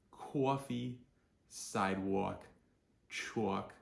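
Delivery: rounded New Jersey AW with a W glide; New Jersey accent. Shown as a demonstration. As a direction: lean into New Jersey vowels, word by word. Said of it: "produce a New Jersey accent".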